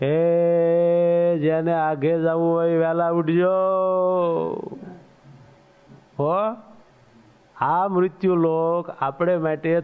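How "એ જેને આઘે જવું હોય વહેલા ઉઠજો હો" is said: long, sustained caller's cry; drawn-out wake-up call. Acted. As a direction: call out to sleepers, stretching the last word. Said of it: "એ બૂમો પાડે".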